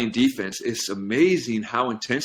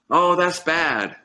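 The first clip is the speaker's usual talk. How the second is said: dismayed crowd; 7 semitones higher, much slower than usual. Retold or imitated. Villagers in the parable lamenting the broken arm, slow mock dismay. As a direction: drag it out like gossiping villagers lamenting. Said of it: "everyone says".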